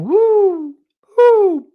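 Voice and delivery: high, loud drawn-out owl hoot. Retold or imitated. Demonstrating the owls' roll call, high loud hooting. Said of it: "The owls will be saying".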